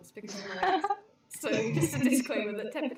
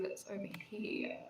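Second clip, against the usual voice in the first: whispering; 3 semitones lower, 10 dB quieter than usual. Real, not acted. Sneaking off mid-scene.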